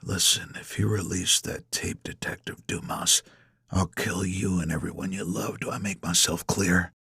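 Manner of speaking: hushed, low menace; very soft, threatening undertone; whispered threat. A narrator's reading. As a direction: whisper it close into the ear. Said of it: "close to my ear and whispers".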